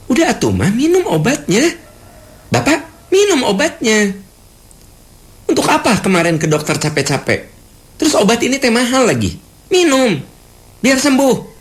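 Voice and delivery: high-pitched, bossy nagging; nagging scold. Acted. Child scolding parents to take their medicine, high nagging. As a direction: push the medicine on them, exasperated and shrill. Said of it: "kita merasa benar karena sayang".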